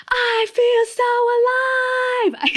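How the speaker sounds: very high, slow, stretched joyful cry; ecstatic scream. Retold or imitated. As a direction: belt it out like you're flying over the waves. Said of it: "I was screaming".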